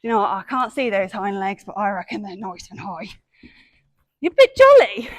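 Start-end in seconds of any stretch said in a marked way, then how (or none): 4.2-5.1 teasing praise. Real, not acted. Teasing the lively pony.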